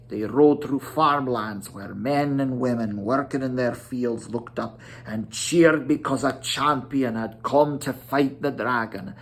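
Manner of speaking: plain reading voice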